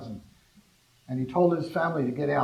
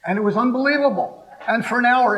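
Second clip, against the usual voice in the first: excited; 9 semitones higher, 8 dB louder than usual. Real, not acted. Excited retelling of hot lab.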